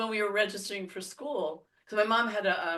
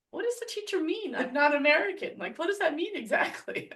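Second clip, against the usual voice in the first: put-on much higher, baffled little girl asking; a kid asking mom what it means, higher and baffled; confused child; 11 semitones higher than usual. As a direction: play her child self, puzzled and pleading.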